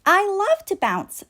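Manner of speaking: high, eager toy ball; bouncy ball voice. A narrator's reading. Boomer tells the toys, high eager ball voice.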